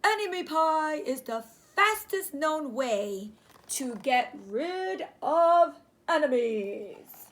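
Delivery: loud, slow, dramatic dad; hammed-up father proclaiming; dad's voice. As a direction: proclaim it like a legend. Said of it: "he said".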